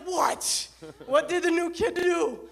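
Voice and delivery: loud, high, exasperated mock yell; alarmed shout. Senior partner to new dispatcher, mock alarmed shout. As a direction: shout it, bracing for the rookie's disaster.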